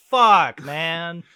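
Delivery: hammed-up dismayed swear; mock gutted curse. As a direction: blurt it out as if gutted. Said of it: "You're like".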